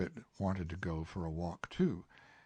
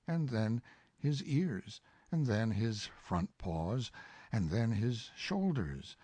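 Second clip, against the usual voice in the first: plain reading voice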